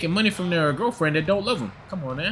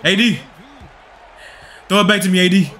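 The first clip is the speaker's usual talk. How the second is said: loud, raised-pitch call to a teammate; 5 semitones higher, 6 dB louder than usual. Real, not acted. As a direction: shout for the ball. Calling for a pass.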